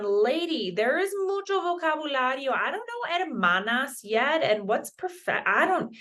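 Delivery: high, exasperated mock student; student protesting to the teacher, mock-whiny; imitating a confused student. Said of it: "you're going".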